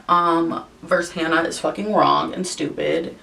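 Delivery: usual speaking voice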